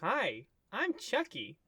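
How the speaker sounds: sing-song like a talking toy from a TV ad; talking doll voice. Acted. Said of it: "And the doll says".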